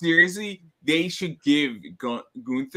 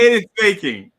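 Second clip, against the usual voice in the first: mock indignation; 7 semitones higher, 10 dB louder than usual. Joking mock outrage.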